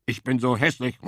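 lightly voiced despairing wild boar; sulking boar. A narrator's reading. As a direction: grumble back from the bushes. Boar laments.

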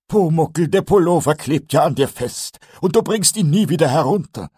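Eder warning. Acted. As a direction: warn the goblin patiently.

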